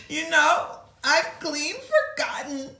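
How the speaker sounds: a touch of a sob; forgetful elephant sobbing lightly. Acted. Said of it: "sighed deeply and sobbed".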